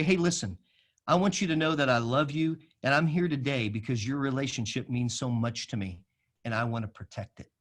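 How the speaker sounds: soft, low, sincere reassurance; gentle affirmation. Shown as a demonstration. Pastor easing into a confrontation to restore a friendship, gently affirming. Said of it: "if you ever hear me say these words".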